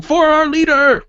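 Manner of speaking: loud, high war cry; rally the troops for a fallen leader; rallying battle cry. Acted. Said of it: "they're saying".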